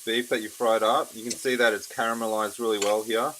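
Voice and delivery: ordinary delivery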